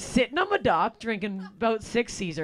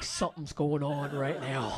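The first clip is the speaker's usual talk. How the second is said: much lower and softer aside; low, soft realization; 9 semitones lower, 9 dB quieter than usual. Retold or imitated. Comic notices Ross's eyes, low and quiet. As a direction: mutter it, suspicious. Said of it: "I'm like".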